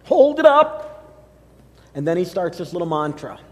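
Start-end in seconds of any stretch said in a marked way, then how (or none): 0.0-1.1 high, loud, slow TV preacher call; mock the televangelist's big opening; imitating Joel Osteen. Pastor mimics Joel Osteen to the congregation, high and loud. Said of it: "He'll say".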